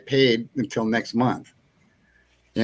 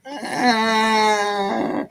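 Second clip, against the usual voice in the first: retold yelp; 10 semitones higher than usual. Falling off cliff, crying out.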